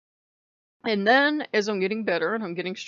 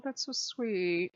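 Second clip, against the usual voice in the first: mock tender; 5 dB quieter, much slower than usual. Odin accepting her flowers.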